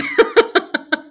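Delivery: high, loud burst of laughter at herself; real burst of laughter. Real, not acted. Laughing at herself in hiking gear at a club, high bursting laugh. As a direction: crack up at the picture of yourself. Laughing at her own outfit.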